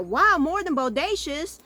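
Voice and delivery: high, loud, drawn-out disbelief; mock amazement. Viewer reacting to the TV bull line, loudly amazed. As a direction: stretch it out in big retold astonishment.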